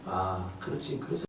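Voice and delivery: gently resigned inner voice; understanding murmur. Retold or imitated. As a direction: murmur it with calm acceptance. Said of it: "희한한 행동을 해도".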